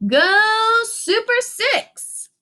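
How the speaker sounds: high, drawn-out cheer; team cheer. A narrator's reading. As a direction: cheer it out slowly, all together. Friends cheer with hands together.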